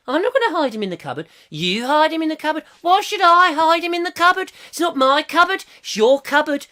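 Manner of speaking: high-pitched Brummie bickering double act; badly faked Brummie, pitched right up; mock Brummie accent. Host re-enacting a radio drama argument, mock Brummie accent. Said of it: "can you do a Brummie?".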